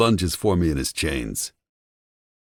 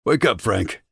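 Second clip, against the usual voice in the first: sharp bark, higher; barking at Frank; 6 semitones higher, 3 dB louder than usual. A narrator's reading. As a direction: bark his name to wake him. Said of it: "slapping his cheek".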